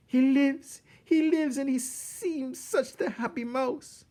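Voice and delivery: very high squeaky mouse voice, loud then easing; amazed Antoinette. A narrator's reading. Mouse seeing Despereaux alive, high squeaky wonder. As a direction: marvel breathlessly, high and fluttery.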